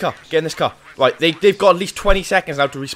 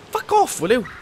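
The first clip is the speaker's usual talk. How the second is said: sudden high exasperated yelp; high yelping brush-off; exasperated shout; 10 semitones higher than usual. Real, not acted. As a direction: snap at the pest with rising pitch. Swearing at an attacker.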